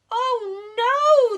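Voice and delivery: very high, loud frightened cry; terrified of the bear; panicked bees. Acted. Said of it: "they cried".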